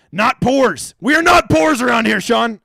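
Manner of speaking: loud, high, playful outrage; a hammed-up cry of pride; mock indignant shout. Mock boast about not being poor.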